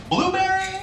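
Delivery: high-pitched questioning customer; mock customer asking, pitched high; clueless customer. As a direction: guess in a high, silly voice. Imitates customer guessing berries.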